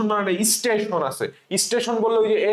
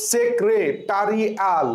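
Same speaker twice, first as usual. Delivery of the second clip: slow spelling-out; much slower than usual. Shown as a demonstration. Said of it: "ঠিক একইভাবে তুমি এখানে আসো".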